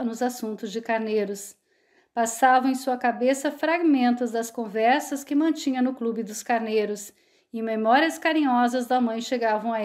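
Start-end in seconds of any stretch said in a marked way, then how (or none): none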